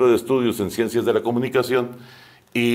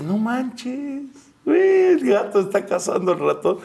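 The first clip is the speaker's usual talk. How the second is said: amazed; 13 semitones higher than usual. Retold or imitated. Retells his own amazed reaction.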